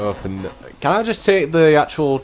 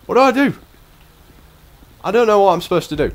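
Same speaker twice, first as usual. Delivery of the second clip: high, fast flustered questions; high rushed panic; panicked; 8 semitones higher, 4 dB louder, faster than usual. Real, not acted. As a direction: panic over what to do. Panicked confusion.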